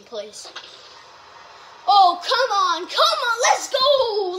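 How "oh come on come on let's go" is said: loud, high urging shouts; excited yelling. Real, not acted. Player urging the game on, excited yelling. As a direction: shout it with mounting urgency.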